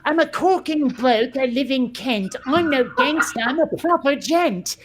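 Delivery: put-on English bloke from Kent, rhymed lines with a bounce; British gent from Kent. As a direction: do a cocky rhyming Kent accent. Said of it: "I'm just picturing, like, like".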